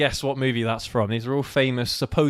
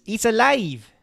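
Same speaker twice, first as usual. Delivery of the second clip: higher, cartoon Mexican accent; mock Mexican accent; 8 semitones higher, slower than usual. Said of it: "the quote is".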